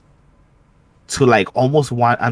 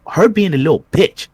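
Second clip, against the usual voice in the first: higher, louder voice for the nosy neighbor lady; 8 semitones higher, 5 dB louder than usual. Retold or imitated. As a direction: play the neighbor butting in.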